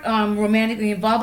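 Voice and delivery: ordinary talk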